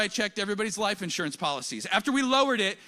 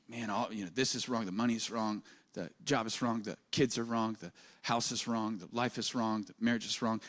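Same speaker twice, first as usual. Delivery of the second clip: low, much softer gloomy muttering of complaints; softer, lower, downcast self-talk; gloomy inner voice; 8 semitones lower, 7 dB quieter than usual. Retold or imitated. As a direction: list every complaint in a dragging negative voice. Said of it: "my mind usually goes to the negative".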